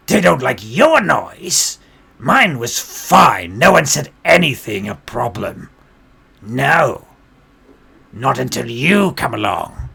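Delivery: loud, drawn-out affronted retort; offended performer, punching the words loudly; indignant goblin bard. Acted. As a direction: throw the blame back, slow and forceful. Goblin bard indignantly answers the orc.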